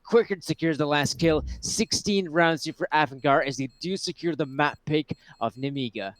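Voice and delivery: loud, pumped round call; esports caster. Real, not acted. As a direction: call the winning kill loudly. Round-winning play live cast.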